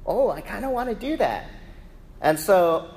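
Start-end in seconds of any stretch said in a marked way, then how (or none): 0.0-1.5 high, lightly voiced curious kid; intrigued child. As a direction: imitate a little girl tempted to join in. Voicing daughter tempted by others' swimming.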